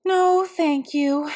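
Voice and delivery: put-on slow sighing girl's reply; a hurt girl politely declining her father; sighed. A narrator's reading. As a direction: sigh it out, a little sad. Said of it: "sighed Camilla".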